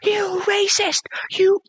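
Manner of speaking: very high, airy mock scream; shrill-pitched whispered mock shriek; mock screaming girls. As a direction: screech it like outraged teen girls. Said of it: "the girls start literally screaming".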